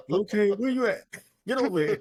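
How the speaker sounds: very high-pitched imitated parent holler; parent calling child. Parent summoning little Kane by name, high mock scolding call. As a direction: call the kid over in a high put-on voice. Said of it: "for me in my house".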